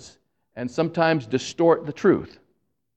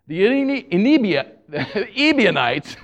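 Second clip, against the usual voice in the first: tongue-tied, higher-pitched, breaking into a laugh; laughing stumble; 7 semitones higher than usual. Real, not acted. Preacher fumbling a group's name before congregation, real laughing stumble. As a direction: trip over the word and laugh at yourself.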